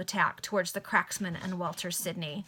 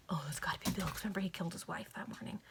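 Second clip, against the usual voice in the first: hushed unvoiced aside, softer; whispered aside; 2 semitones lower, 10 dB quieter, faster than usual. Real, not acted. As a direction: lean in and whisper the realisation. Reader's whispered aside to listeners.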